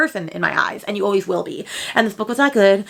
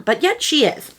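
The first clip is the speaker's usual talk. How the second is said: normal talking voice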